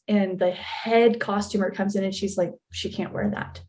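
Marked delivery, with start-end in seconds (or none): 2.7-3.6 much softer, almost voiceless; hushed objection. Retold or imitated. Head costumer objecting to an extra's outfit, hushed.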